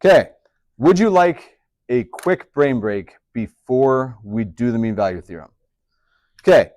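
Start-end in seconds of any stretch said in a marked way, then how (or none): 0.8-5.5 asked as a question but ending on a fall; slow question that falls away at the end; question with falling tune. Shown as a demonstration. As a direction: drop the pitch at the end of the question, no rise. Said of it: "but I did not end with an upward inflection".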